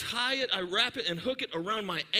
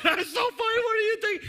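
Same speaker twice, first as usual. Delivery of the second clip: much higher, louder laughing mom; squealing with laughter at the kid; 13 semitones higher, 6 dB louder than usual. Retold or imitated. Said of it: "she just busts out laughing".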